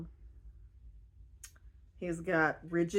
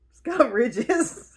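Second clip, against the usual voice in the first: laughing; 3 semitones higher, 5 dB louder, slower than usual. Real, not acted.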